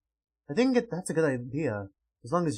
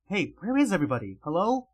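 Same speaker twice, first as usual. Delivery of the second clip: usual speaking voice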